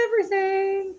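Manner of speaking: mock wail. Real, not acted. Exaggerating own crying.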